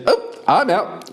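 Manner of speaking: high voice bailing out; high panicked archer. Acted. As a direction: pipe it as she flees. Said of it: "and she goes".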